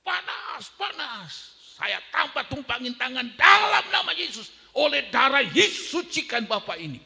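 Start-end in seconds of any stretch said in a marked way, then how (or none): 0.0-1.3 high cry. Retold or imitated. Man still crying out. 3.4-7.0 high, loud proclamation; commanding prayer. Retold or imitated. Pastor laying hands on the big man, loud high prayer.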